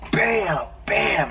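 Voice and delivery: high, drawn-out imitation of blows; punchy impact words. Retelling Peter beating up the Goblin, punchy impact words. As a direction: land each word like a punch.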